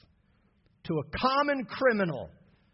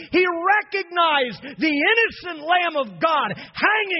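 preaching at full force; 4 semitones higher, 4 dB louder than usual. Acted. Preacher to his church, rising to a cry. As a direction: build from strong preaching to a shouting climax.

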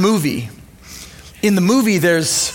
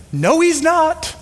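high, slow sulky denial; a grieving cub pushing back; stubborn denial; 9 semitones higher, slower than usual. Retold or imitated. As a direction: refuse it like a hurt young lion. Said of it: "Simba's like".

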